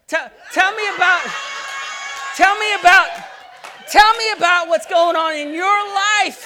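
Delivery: high, loud, pleading tell me; pleading shout. Acted. Preacher pleading aloud with God in the truck. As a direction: beg them loudly to talk.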